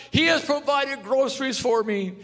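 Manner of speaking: put-on high loud joyful old lady; old lady crying out. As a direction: shout for joy as the old woman. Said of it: "she cried out".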